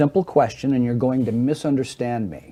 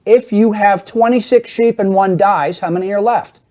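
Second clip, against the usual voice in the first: saying it louder; 6 semitones higher, 5 dB louder than usual. Shown as a demonstration. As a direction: project each word for someone hard of hearing. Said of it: "Let me say it louder".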